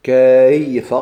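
كيف said loud and separate; loud example word. Shown as a demonstration. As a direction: call out كيف clearly to the class. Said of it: "قل كلمة كيف". (نطق كيف بصوت عال)

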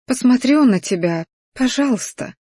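high, sweet little plea; pleading. A narrator's reading. Woman pleads to stay with him.

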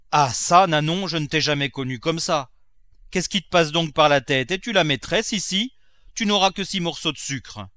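loud, high-pitched scolding master; indignant miser Grandet. Acted. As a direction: put the servant firmly in her place.

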